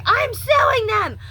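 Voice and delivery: high, loud and slow, outraged; furious outburst. Real, not acted. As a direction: declare it in fury. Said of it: "I flipped out".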